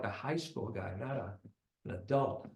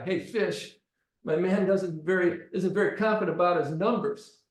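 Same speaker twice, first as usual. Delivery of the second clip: raised, louder taunting voice; mock taunt; 4 semitones higher, 6 dB louder than usual. Gunman mocking the boy to Fish, loud taunt. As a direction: make a show of him to your buddy.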